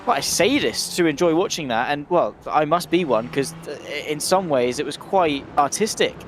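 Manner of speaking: normal talking voice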